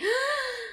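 sharp high startled gasp; retold gasp of disbelief. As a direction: suck in air in shock. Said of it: "era como que".

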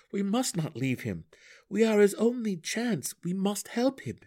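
high, urgent, pleading hero voice; impassioned Drizzt. Acted. Drizzt argues to help his only friend, impassioned Drizzt.

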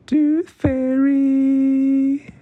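high, slow, drawn-out cry of "Tooth fairy!"; joyful shout. Acted. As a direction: belt it out like two thrilled kids. Both shout joyfully together.

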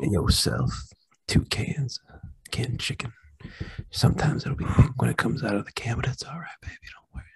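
breathy seductive whisper; sultry whisper. Acted.

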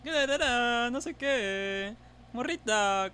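high, drawn-out mock Mexico City singsong; stretched singsong chilango whine; chilango accent. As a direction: badly imitate a chilango. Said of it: "no hablamos como los chilangos".

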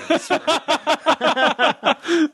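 long, high fit of giggles; real laughter. Real, not acted. Son laughing at his dying mom's joke, high giggling. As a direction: crack up helplessly at the punchline. Laughs at own morbid punchline.

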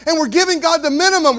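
loud, high, driving sermon voice; fiery pulpit climax; preaching at full force. Acted.

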